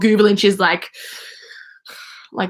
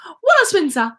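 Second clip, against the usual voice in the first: put-on a light British accent, a touch higher; 8 semitones higher, 3 dB louder than usual. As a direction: announce the name like a posh British detective. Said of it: "where she's British".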